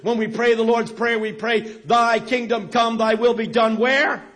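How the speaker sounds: preacher proclaiming the kingdom to the crowd; preaching. Acted. Preaching the good news.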